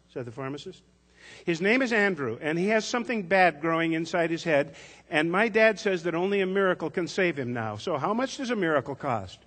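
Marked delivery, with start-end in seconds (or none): 1.4-9.4 put-on much higher, louder child voice, urgent; a child explaining earnestly; little girl pleading. A narrator's reading. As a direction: Tess telling the pharmacist about her brother. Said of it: "Tess answered".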